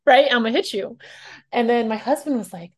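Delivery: normal talking voice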